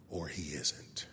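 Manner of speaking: hushed whisper. Real, not acted. Preacher whispering the conclusion to the congregation. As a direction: drop to a whisper.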